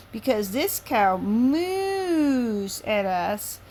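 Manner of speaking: very slow, stretched story voice; slow sing-song reading; drawn-out storytelling. Acted. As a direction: stretch every word like a bedtime book. Child reading her made-up book.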